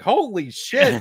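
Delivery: high, loud, slow astonishment; a viewer reacting to a gross movie death, stunned; shocked. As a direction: blurt it out, stunned. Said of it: "I was like".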